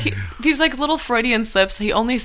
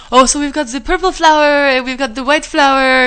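imitating the professor's thick Brazilian accent, pitched higher and louder; mock Brazilian professor, raised and loud; Brazilian-accented lecture voice, playful; 5 semitones higher, 4 dB louder than usual. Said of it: "he's got this really thick Brazilian accent".

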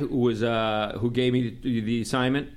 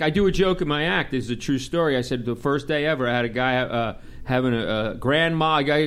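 ordinary talk

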